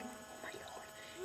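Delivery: breathless hushed whisper. Retold or imitated. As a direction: whisper it so the cat won't hear. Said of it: "I'm like".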